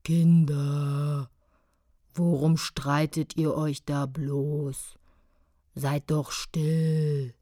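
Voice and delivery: tiefer, langsamer Elefant; grollende Elefantenstimme. A narrator's reading. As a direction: grolle langsam und gutmütig. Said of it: "gurgelnde Stimme grollend vernehmen ließ". (langsam grollender Elefant)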